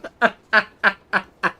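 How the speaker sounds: high, loud staccato laugh; laughing fit. Real, not acted. Streamer mocking a naive chatter, high staccato laugh. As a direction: keep laughing in bursts, easing off.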